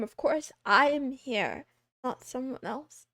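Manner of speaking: ordinary delivery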